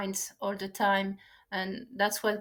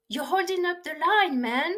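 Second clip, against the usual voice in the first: impatient lady; 6 semitones higher than usual. Retold or imitated. Lady in line scolding the young bagger, mock impatient. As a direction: snap at him to hurry up, hammed a bit. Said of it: "the lady behind us leans over and, you know, tells to the little bagger".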